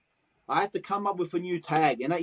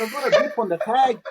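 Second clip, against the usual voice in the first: incredulous echo; 4 semitones higher, 5 dB louder than usual. Retold or imitated. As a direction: repeat "got" back in disbelief, louder and higher. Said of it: "I said".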